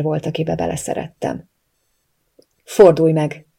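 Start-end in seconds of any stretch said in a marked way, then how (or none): 2.6-3.5 loud, hard-voiced order; hard command. A narrator's reading. Armed man ordering his captive, hard command. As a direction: order it harshly, with force. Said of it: "utasított kemény hangon".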